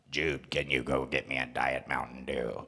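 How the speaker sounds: low, flat machine voice reading typed text; robotic text-to-speech voice. Retold or imitated. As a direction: play the phone app speaking for him. Typed app voice asking son.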